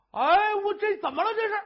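high, loud shocked outburst; startled cry. Acted. Husband finding his wife at the rope, loud startled cry. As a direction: jolt out the words in alarm.

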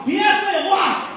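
the judge shouting down the butcher's excuses; angry judge. Acted. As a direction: cut him off and roar at him.

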